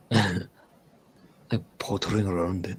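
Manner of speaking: put-on slow, lilting Scottish accent. Slow Scottish accent for the viewers. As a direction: roll it out in a light Scottish lilt. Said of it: "Oh, there you go".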